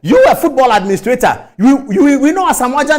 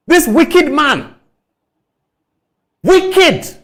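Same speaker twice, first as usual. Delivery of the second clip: scornful denunciation; 6 semitones higher, much slower than usual. Real, not acted.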